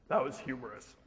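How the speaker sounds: low, soft deadpan; humorless dad. Retold or imitated. Dad commenting to his neighbour on the joke, deadpan. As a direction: imitate a dad unmoved by the joke. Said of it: "My dad had delayed humor".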